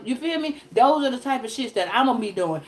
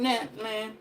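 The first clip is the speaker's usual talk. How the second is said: soft aside; 3 semitones lower, 9 dB quieter than usual. Real, not acted. Quiet aside.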